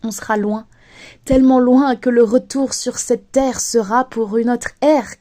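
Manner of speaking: ordinary talk, nothing marked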